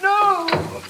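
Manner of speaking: panicked pleading. Acted. Patient dragged back to ward.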